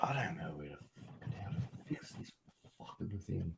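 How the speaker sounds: whispered cursing under breath; frustrated whisper. Real, not acted. Streamer stuck fixing something, whispering curses to himself. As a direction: hiss it to yourself, annoyed.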